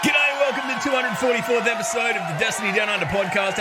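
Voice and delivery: podcast host opening. Acted. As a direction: open the show like a broadcaster.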